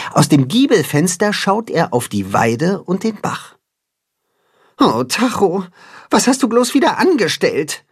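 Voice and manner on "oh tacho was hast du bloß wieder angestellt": higher, wistful little sigh of worry; exasperated sighing child voice. Acted. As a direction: sigh it out, fondly fed up with his friend. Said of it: "seufzt Timme".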